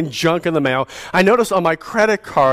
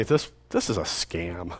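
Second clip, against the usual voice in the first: ordinary delivery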